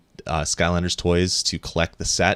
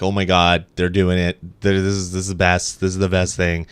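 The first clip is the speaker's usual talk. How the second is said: louder, gushing retold delight; excited reaction; 5 dB louder than usual. Podcaster retelling reaction to the huge news, excited. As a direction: act out your thrilled reaction. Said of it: "I was like".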